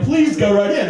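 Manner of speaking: high-pitched, mock-urgent welcome; obliging attendant. Attendant letting the father into the club, obliging. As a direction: wave him in, imitating an eager attendant. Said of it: "the attendant's like".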